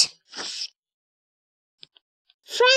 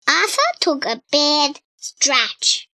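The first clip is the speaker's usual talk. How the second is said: usual speaking voice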